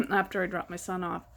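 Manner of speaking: normal talking voice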